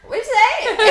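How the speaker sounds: high, loud mock greeting; playful imitation of English slang; imitated roommate greeting. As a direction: call it out like a boisterous housemate.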